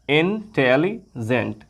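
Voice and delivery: इंटेलिजेंट said slowly in three parts, in-tel-li-gent; split into syllables. Shown as a demonstration. Teacher showing students the three syllables of इंटेलिजेंट, slowly. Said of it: "तीन part में हम इसको बोल रहे हैं".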